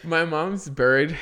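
real laughter over slow words; laughing. Real, not acted. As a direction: push the words out while laughing. Starting story while laughing.

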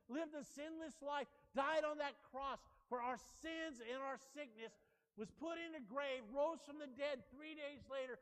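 rising to a loud shout; building sermon climax. Acted. Pastor driving home the gospel to the church, building to a shout. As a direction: build and build to a roaring close.

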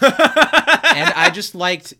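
real laughter breaking through the words; laughing. Real, not acted. Cracking up while telling the co-host. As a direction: laugh it out, high and loud.

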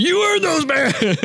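high, slow shouted praise; yelled praise. Retold or imitated. Hunter yelling to his friend about earned bands, high and slow. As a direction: yell it out, pumped up. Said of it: "but I yelled at him".

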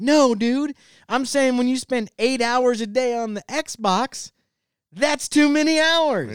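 high, exasperated dad protest. Retold or imitated. Dad scolding his son over too much Xbox, exasperated.

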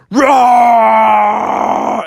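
T-Rex roar. Acted. T-Rex roars at bears.

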